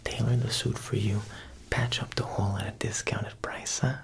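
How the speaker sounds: ordinary talk, nothing marked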